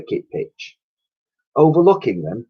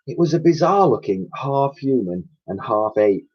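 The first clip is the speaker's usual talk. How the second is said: plain reading voice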